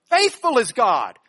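"Faithful" punched loudly at the front; stress on faithful. Shown as a demonstration. Preacher shows Paul's emphasis, loud stress on "Faithful". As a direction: drive home "Faithful", then fall away. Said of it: "That's his emphasis on this sentence".

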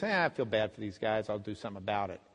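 low, offhand mumbled mock indifference; insincere shrug. Imitating a Jesus who hardly cares, offhand and low. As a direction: toss it off as if barely bothered. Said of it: "It's very sincere. It's not".

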